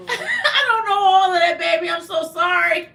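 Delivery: real laughter over the words; laughing. Real, not acted. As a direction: giggle while admitting you don't know. Laughing at a French message.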